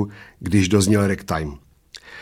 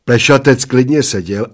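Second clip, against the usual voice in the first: plain reading voice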